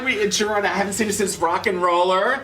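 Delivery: high, loud, gushing hello; excited greeting. Real, not acted. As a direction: greet him with bubbly enthusiasm. Excited greeting on red carpet.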